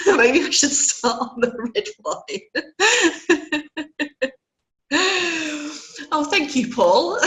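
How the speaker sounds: really laughing through the words; amused laughing. Real, not acted. Webinar host jokes about red wine for her throat, laughing. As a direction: joke it out, breaking into giggles.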